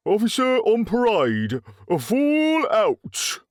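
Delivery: high, drawn-out parade shout; shouted order. A narrator's reading. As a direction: bellow it across the parade ground. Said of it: "shouted Scarlett O'Hara".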